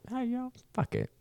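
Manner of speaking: a high, jokey cartoon squeak; mock high squeaky voice. Soldier voices the bear in a squeaky high voice. Voicing a bear eating him.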